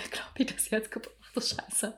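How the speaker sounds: a hushed whispered confession; guilty whisper. Real, not acted. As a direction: drop to a whisper as it dawns on you. Realizes she broke his heart.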